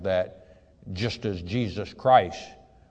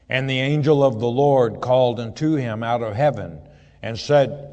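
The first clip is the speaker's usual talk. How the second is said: raised, measured Bible reading voice; scripture reading; 3 semitones higher, 6 dB louder than usual. Acted. Preacher reading Genesis aloud to his flock, solemn reading voice. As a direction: read the verse out to the congregation. Reads verse eleven aloud.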